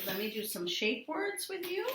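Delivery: ordinary talk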